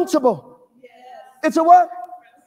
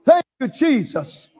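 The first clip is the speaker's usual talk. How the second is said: ordinary talk, nothing marked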